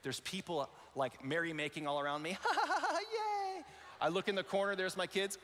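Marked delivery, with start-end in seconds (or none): 2.4-3.6 high, cheering laughter; mock partying. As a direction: ham up carefree party guests. Merrymakers celebrating around him.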